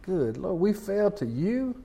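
half-whispered mock shock; stunned disbelief. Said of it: "we're gonna be like".

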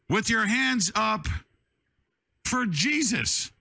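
high, slow repeat of the loudspeaker call; megaphone call. Retold or imitated. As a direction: say it again like over a loudspeaker. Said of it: "Now I got on a big speaker".

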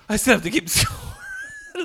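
very high squeal into laughter; comically exasperated, cracking up; laughing panic. Retold or imitated. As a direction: stretch it out and let the voice shoot up. Retells own panic, laughing.

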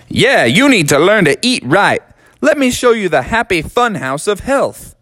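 high folksy warning voice; Colonel lecturing. Acted. Colonel warning Froggy about lazy junk food, high folksy voice. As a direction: warn the boy like a stern southern gent.

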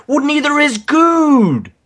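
high squeaky retort. Retold or imitated. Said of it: "I'm like".

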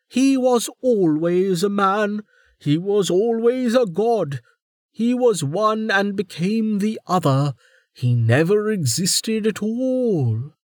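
high, emphatic and irritated; exasperated warden. A narrator's reading. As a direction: throw up her hands.

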